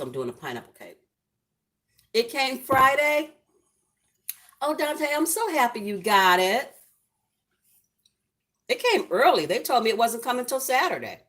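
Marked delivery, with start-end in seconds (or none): 2.1-3.3 high, loud and drawn out with delight; bursting with joy over the gift; gushing squeal of happiness; thrilled. Real, not acted. Thrilled gift arrived early. 4.6-11.2 high, loud happy gushing; excited squealing praise; overjoyed. Real, not acted. Reacting to gift arriving early.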